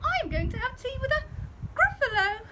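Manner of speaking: high, bright little mouse voice. Acted. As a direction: answer politely like a clever small mouse. Mouse replies to owl.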